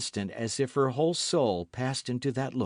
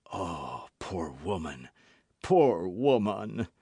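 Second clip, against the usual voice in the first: broken murmur; 4 semitones lower, slower than usual. A narrator's reading. Athos pitying the poisoned woman, broken murmur. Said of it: "murmured Athos in a broken voice".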